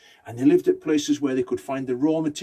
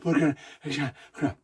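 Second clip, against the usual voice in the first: out of breath. Acted. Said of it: "they're out of breath".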